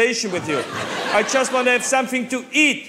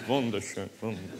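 softer, drifting off in awe; dreamy fade-out; 13 dB quieter, faster than usual. Acted. Tourist gazing from hotel window, praise fading to almost nothing. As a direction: let the praise melt away to nothing.

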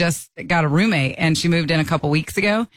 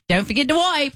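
teasing roommate; 8 semitones higher than usual. Retold or imitated. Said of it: "She's like".

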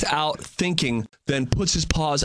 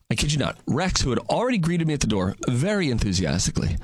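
ordinary talk, nothing marked

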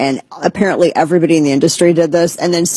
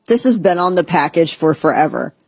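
normal talking voice

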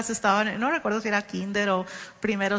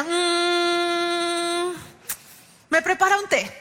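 niño pidiendo té; 6 semitones higher, much slower than usual. Retold or imitated. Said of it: "le hacía".

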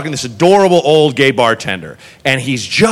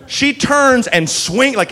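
ordinary talk

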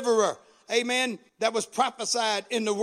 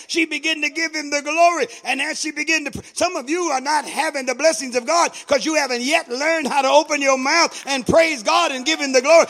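loud, high-pitched driving exhortation; full-force preaching; 4 semitones higher, 6 dB louder than usual. Real, not acted. Preacher urging the congregation to praise God, full-force preaching. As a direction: build phrase on phrase to a shout.

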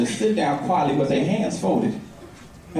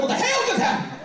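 baffled. Retold or imitated. Uncle baffled.

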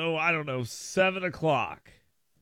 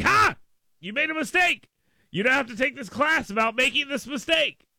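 mock gotcha; 7 semitones higher than usual. Host imitating company testers catching staff out, mock gotcha. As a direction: pounce like someone who just caught a culprit.

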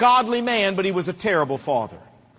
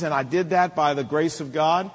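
normal talking voice

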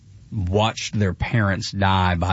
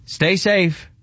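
mild mock shout; 9 semitones higher than usual. A narrator's reading. A man reads his own waving farewell from a written piece, mild mock shout. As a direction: read out a shout, only half calling it. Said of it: "I waved and shouted".

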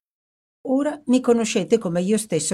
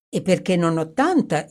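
plain reading voice

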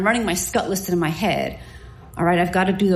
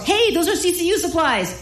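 sharp, much higher yell of a guarding nurse; 9 semitones higher than usual. Retold or imitated. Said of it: "just as the nurse yells".

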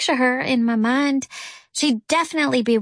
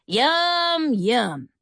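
exaggerated tempting. A narrator's reading. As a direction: tease with a tasty treat. Tempting the parrot with a cracker.

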